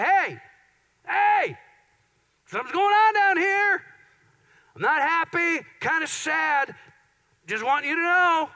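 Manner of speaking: high, loud squeaky little voice calling up from inside; a small worried voice tugging for attention; playful inner voice. Acted. The churning emotion in your heart calling up, squeaky and high.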